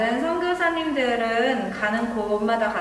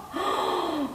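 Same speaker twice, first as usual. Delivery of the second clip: shocked gasp; 7 semitones higher, 5 dB quieter than usual. Acted.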